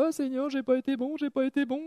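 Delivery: high, soft whining plea to God; hammed-up tearful confession, pitched high; mock tearful repentance. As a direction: whimper it in a put-on crying voice. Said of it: "c'est se mettre à pleurer".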